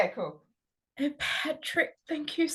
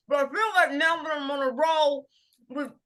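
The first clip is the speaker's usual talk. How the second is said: normal talking voice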